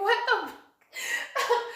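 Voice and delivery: slow, high, laughing "what the f"; shocked disbelief. Retold or imitated. Retelling her stunned reaction to a stranger, high laughing disbelief.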